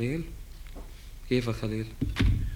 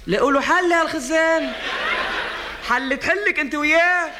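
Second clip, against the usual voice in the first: excited ranting; 7 semitones higher than usual. Acted. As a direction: rant about the tank, voice pitched up. Ranting about water tank, high. (نقّ متحمس بصوت عالٍ ومرتفع)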